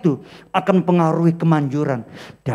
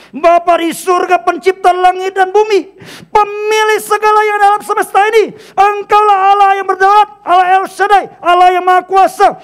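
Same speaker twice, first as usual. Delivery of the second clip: loud grand prayer; 2 semitones lower, 4 dB louder than usual. Shown as a demonstration. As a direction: pray it big, like a healing preacher with the whole room listening. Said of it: "doa yang keras atau intonasi".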